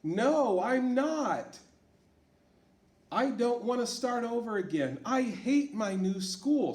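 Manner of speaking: put-on high, loud protest of a stubborn young girl; girl refusing the new school, upset. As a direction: whine it out as a kid who hates change. Teacher voices Sarah in story.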